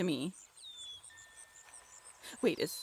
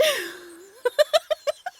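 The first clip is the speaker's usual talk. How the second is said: a high, loud burst of laughter; 7 semitones higher, 6 dB louder than usual. Acted. Realizing the partner was teasing, burst of laughter.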